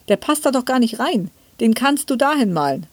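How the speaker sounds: lightly acted motherly correcting; mother's objection. A narrator's reading. Mother objects to child's drawing.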